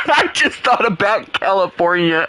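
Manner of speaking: really amused, mid-giggle; laughing while talking. Real, not acted. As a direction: get it out between laughs. Speaking between bursts of laughter.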